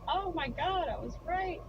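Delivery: excited. Retold or imitated. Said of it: "you're just like".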